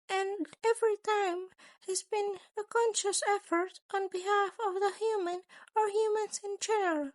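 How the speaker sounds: a sly creature principal, amused and menacing. A narrator's reading. Narrator voices Nezu again.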